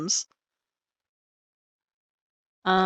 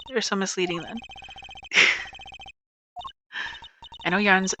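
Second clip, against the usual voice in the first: usual speaking voice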